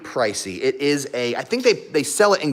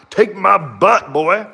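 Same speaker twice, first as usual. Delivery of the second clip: slow mock dog voice, higher; voicing the dog; 4 semitones higher, 4 dB louder, slower than usual. Owner voicing her husky's thoughts, slow and playful. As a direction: put words in the husky's mouth. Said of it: "she's like".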